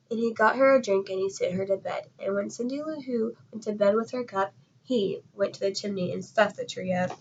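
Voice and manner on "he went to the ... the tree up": plain reading voice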